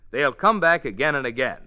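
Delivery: ordinary talk, nothing marked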